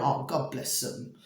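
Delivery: soft, fond murmur, dropped low; tender aside. Real, not acted. Speaker fondly blessing the foxes she feeds, softly. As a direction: coo it fondly under your breath.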